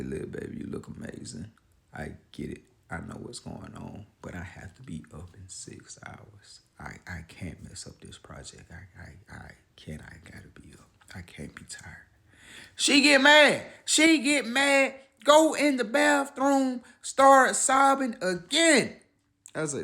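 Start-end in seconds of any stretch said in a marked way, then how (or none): 0.0-11.9 hushed late-night whisper, much softer. Retold or imitated. Husband whispering to his wife at midnight, hushed. 12.7-18.9 worked-up retelling. Retold or imitated. Retelling her upset.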